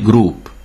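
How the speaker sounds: group in French with an "oo" vowel. Shown as a demonstration. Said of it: "O U betűcsoportot U-nak ejtjük".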